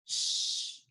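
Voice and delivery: unvoiced sh hiss, very soft; just breathe the sh sound; whispered sh. Shown as a demonstration. Teacher cueing the digraph sound in a whisper. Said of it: "tell me what sound".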